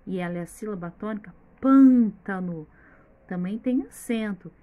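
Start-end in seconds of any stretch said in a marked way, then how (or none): 1.6-2.7 Pântano slow, PÂN very loud and long; loud, stretched first syllable in Pântano; stress on first syllable. Shown as a demonstration. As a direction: hit the PÂN of Pântano hard, then ease off. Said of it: "o pan que é o mais forte".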